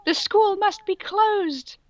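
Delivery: high, slow, stern official voice; shrill official. Acted. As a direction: proclaim it in a shrill old-woman voice. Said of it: "shouted angrily".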